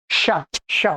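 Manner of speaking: "श" as two separate low syllables; palatal SH demo. Shown as a demonstration. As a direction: hiss "श" from the palate, then a short low vowel. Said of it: "वास्तव में तालब्य श है". ("श" का तालव्य उच्चारण)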